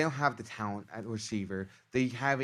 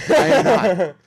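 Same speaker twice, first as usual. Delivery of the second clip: loud, high, slow through laughter; cracking up on the words; laughing admission; 5 semitones higher, 12 dB louder, slower than usual. Real, not acted. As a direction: laugh while owning up. Laughing at listener's remark.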